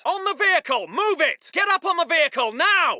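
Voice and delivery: high, insistent command; shouted order. Acted. Officer ordering men onto the vehicle, shouting. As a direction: shout them into moving.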